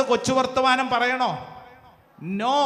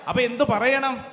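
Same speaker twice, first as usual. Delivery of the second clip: usual speaking voice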